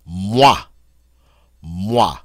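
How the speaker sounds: "moi" said mwa, as in watch; French "moi", set apart as a model; French wa sound. Shown as a demonstration. Teacher gives French word for me, wa sound. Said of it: "wa, as in the French word for me".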